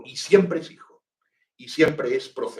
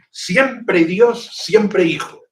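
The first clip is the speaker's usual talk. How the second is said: higher and louder, proclaiming the formula; emphatic quotation; 7 semitones higher, 8 dB louder, much slower than usual. Retold or imitated. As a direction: ring it out like a creed. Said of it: "nuestro Alejandro".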